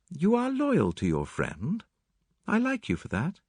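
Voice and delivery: high, measured woman's voice; Mary Cavendish. Acted. Mary praises Hastings' loyalty, high measured voice.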